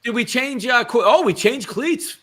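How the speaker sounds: high, loud surprised question; suddenly notice something on screen. Real, not acted.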